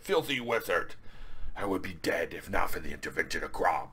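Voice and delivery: hammed-up fantasy warrior; Conan's voice. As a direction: spit the insult, then declaim solemnly. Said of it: "this is the voice I hear in my head".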